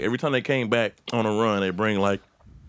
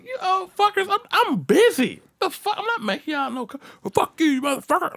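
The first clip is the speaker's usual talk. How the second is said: mock outburst in a much higher voice; high, fed-up comic rant; exasperated retort. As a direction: retell an indignant, high-pitched cussing-out. Retelling his outburst at drivers.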